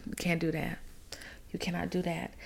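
sinks to an unvoiced whisper; dropped whisper. Real, not acted. To the listener about the floor plan, whispered warning. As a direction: hush it down to a whisper.

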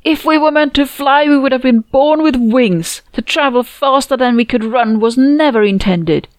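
high, loud shriek. A narrator's reading. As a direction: shriek it like a fanatic. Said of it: "she shrieked".

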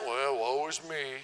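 low, slow mopey voice; Eeyore. Pretend. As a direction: sigh it out like a gloomy donkey. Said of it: "Eeyore's like".

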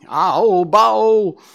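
high, loud panicked scream. A narrator's reading. Faline screaming at the huge stag. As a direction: scream it out in terror. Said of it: "went on screaming".

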